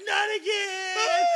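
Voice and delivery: high, loud, drawn-out cry of dread; wailing scream. Retold or imitated. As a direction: scream it as a hammed-up wail. Screaming in fear of dad.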